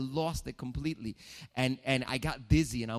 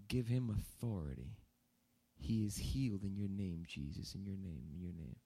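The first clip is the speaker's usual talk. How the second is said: soft prayer; 3 semitones lower, 6 dB quieter than usual. Retold or imitated. Dad praying, retold.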